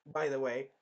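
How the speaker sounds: normal talking voice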